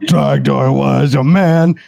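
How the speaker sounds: slow, hammed-up cartoon voice; lightly voiced Strong Bad. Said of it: "You sound like Strong Bad".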